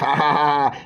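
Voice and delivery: put-on "ha ha ha" laugh; mock laughter. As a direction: imitate a laughing emoji mockingly. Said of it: "emoji wey dey laugh".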